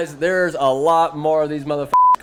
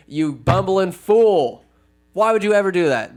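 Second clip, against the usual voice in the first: mock scolding; 4 semitones higher, 4 dB louder than usual. Wizard scolding hobbit in retelling.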